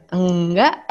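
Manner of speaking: low, drawn-out "no" with a lift; mock-sulky, rising denial; coy wife's denial. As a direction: lightly act a wife denying her anger. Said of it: "Ah, itu cantik".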